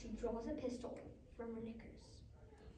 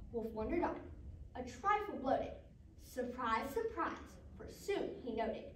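usual speaking voice

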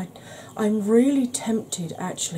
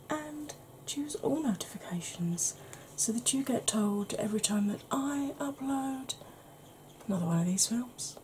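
ASMR whisper; 5 semitones higher, 8 dB quieter than usual. Acted. Whispered outro requests.

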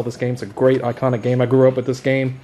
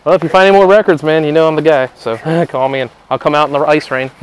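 ordinary talk, nothing marked

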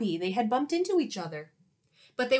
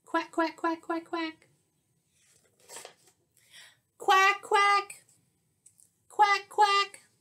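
much higher quacking, rising in pitch; duck chorus; 10 semitones higher, slower than usual. Acted. Ducks quacking back at the teacher, lively. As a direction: answer as a flock of ducks.

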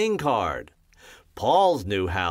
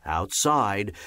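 ordinary delivery

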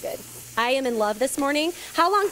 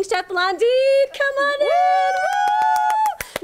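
louder and much higher, "Blondine" drawn out slowly; excited welcome; 12 semitones higher, 5 dB louder, much slower than usual. Real, not acted. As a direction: hype the guest's entrance with a big woo. Welcoming special guest on live TV.

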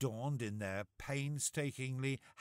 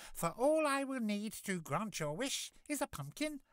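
high, kindly fairy voice; sweet magical godmother; 10 semitones higher than usual. Acted.

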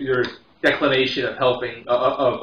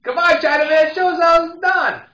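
put-on much higher, loud drunk jeer; drunk goading; 5 dB louder than usual. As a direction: taunt him to perform.

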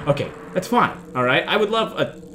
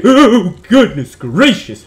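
loud yelp, then slow shocked words; startled exclamation; 5 semitones higher, 11 dB louder, much slower than usual. Real, not acted. Player meeting someone huge on the road, startled exclamation. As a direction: cry out in alarm, stretching the words.

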